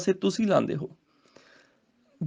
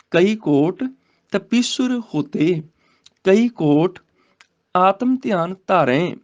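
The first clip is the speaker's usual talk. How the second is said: slow, measured verse reading; scripture recitation; 4 dB louder, slower than usual. Shown as a demonstration. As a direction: recite the sacred verse, drawing out the words.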